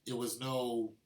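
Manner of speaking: ordinary delivery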